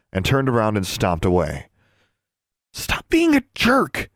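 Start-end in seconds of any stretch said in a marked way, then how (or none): 2.7-4.1 slow, high, strained hiss. A narrator's reading. Alexandra telling off her brother, hissed. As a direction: hiss it at him, drawing out the words. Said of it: "Alexandra hissed".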